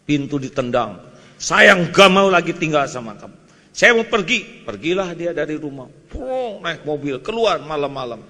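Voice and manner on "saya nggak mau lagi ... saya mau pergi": louder, higher husband storming out; a hint of an angry husband; mock angry husband. Angry husband quoted in story.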